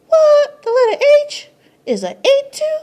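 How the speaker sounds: high and loud, half laughing; hammed-up shock. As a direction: shriek your disbelief, laughing through it.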